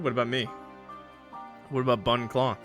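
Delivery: normal talking voice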